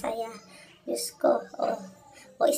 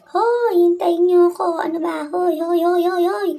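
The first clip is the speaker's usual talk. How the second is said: loud, high calls of hey after friends; calling out hoy to friends walking ahead; shouting to wait; 6 semitones higher, 8 dB louder, slower than usual. Real, not acted. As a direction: yell after them to wait up. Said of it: "Sisigaw ako maya-maya dyan".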